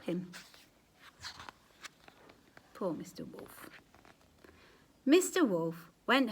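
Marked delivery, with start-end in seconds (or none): 2.7-3.7 whisper. Real, not acted. Whispered pitying aside.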